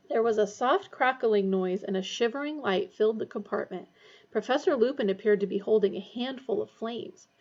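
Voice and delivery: plain reading voice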